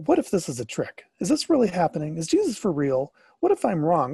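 plain reading voice

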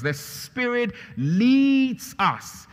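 leads drawn out loud and high; booming slow emphasis on leads; emphatic slow stress. Shown as a demonstration. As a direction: stretch out every word, leads strongest. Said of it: "I'm just trying to emphasize".

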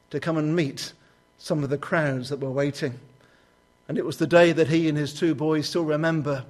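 ordinary talk

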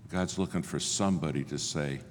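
usual speaking voice

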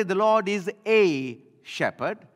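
the word "a" leaned on, slow and lifted; slow it down and lean on "a" as the wrong version; stress on a. Shown as a demonstration. Preacher contrasting David's words, stressing "a". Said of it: "He did not say".

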